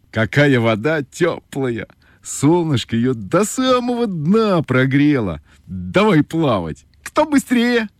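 a lightly voiced cheerful frog. A narrator's reading. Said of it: "frog invites to swim".

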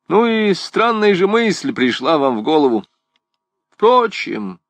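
lightly acted musing aloud; rambling philosopher. Gringoire reacts to the priest's strange idea, lightly acted bemusement.

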